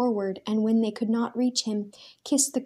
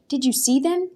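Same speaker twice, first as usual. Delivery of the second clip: higher, louder urgent question; demanding; 6 semitones higher, 5 dB louder than usual. A narrator's reading. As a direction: press them for an answer. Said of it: "he demanded".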